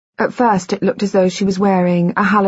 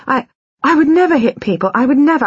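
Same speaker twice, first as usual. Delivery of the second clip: never pushed high and loud; stress on never; 8 semitones higher, 4 dB louder, faster than usual. A narrator's reading. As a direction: hit never hard in panic. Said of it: "panic hit me then".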